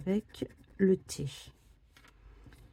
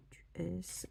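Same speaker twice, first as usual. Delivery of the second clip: whisper; 13 dB quieter than usual. Real, not acted. Scratching ticket, murmuring to self.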